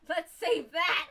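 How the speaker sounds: loud, high, giddy exclamation; excited shout. Real, not acted. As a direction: shout it gleefully, on the edge of laughter. Relieved to save game progress.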